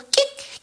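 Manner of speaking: sharp high vocal click; quick squeaky sound effect; imitated TV switch-on squeak. Wife switching on baseball for husband, squeaky switch sound.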